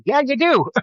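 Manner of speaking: high-pitched teasing comeback; teasing contradiction. Retold or imitated. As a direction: insist playfully, voice leaping up. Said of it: "And it's like".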